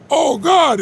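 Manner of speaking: high-pitched retold outburst of astonished gratitude; overwhelmed exclamation. Grateful woman at the door seeing groceries, overwhelmed exclamation.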